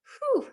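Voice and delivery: a shaky sigh holding back tears. Real, not acted. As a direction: exhale hard, fighting tears. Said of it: "I'm gonna try not to cry".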